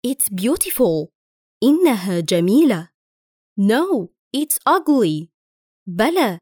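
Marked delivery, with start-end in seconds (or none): 0.1-1.1 slow, careful English phrase; slow model reading. Shown as a demonstration. Teacher reads the model line to learners, slow and clear. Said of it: "Listen and repeat". 3.6-5.3 slow and clear, ugly falling at the end; slow model reading. Shown as a demonstration. Teacher contradicts in a drill, slow and clear. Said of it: "Listen and repeat".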